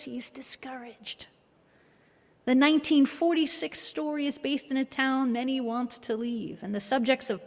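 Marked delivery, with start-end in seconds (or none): 0.0-1.3 slow soft whisper. Retold or imitated. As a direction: drop into a hushed whisper. Clarence quote, hushed.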